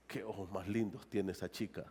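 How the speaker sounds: hushed, dreamy admiration, pitched low; softly gushing over lovely eyes; admiring murmur. Acted.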